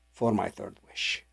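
ordinary delivery

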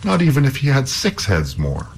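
lightly voiced stubborn hero; defiant youth. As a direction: dare the troll boldly. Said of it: "said Halvor".

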